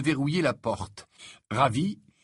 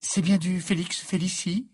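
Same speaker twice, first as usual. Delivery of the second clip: higher, uneasy check; anxious question; 6 semitones higher than usual. A narrator's reading. Hermione checks the potion bottle, anxious. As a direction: ask with a worried edge. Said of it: "interrogea Hermione anxieuse".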